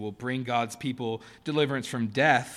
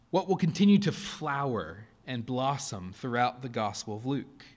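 ordinary talk

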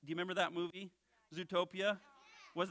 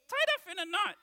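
high, loud puppet guess; confident puppet blurting a wrong answer; Bones; 13 semitones higher, 4 dB louder than usual. Pretend. Puppet guessing the verse, high loud voice. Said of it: "I'm your ventriloquist".

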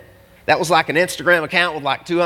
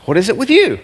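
"What is it with you?" raised in pitch, "you" stressed and falling; emphatic inflection; 5 semitones higher, 4 dB louder than usual. Shown as a demonstration. Preacher puts the question to the congregation, emphatic on "you". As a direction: ask "What is it with you?" higher, driving home "you". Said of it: "emphasize, inflection, it's important".

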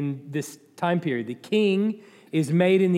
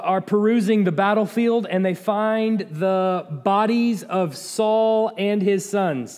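usual speaking voice

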